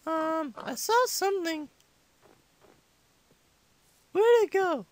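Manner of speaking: put-on little kid gamer voice; five-year-old impersonation. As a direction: chat to viewers like a squeaky young child. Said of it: "I speak so much in this voice".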